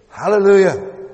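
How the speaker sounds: loud high cry of praise; shouted hallelujah. Acted. Preacher voicing the multitude shouting in heaven, loud. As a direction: lift it up like the crowd in heaven. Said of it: "they're shouting this word".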